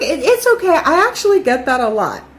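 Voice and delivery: imitated sweet lady, pitched high; sweet reassurance, starting high and settling down. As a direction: put on a high, sweetly forgiving voice. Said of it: "this lady was so sweet".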